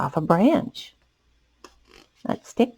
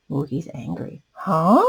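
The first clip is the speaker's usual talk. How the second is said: usual speaking voice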